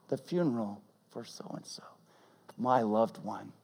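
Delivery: whispered, breath only; whispered mourning thought; hushed whisper. Retold or imitated. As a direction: whisper it like a private grief. Grieving worshipper's inner thought.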